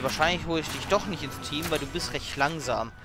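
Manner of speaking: ordinary delivery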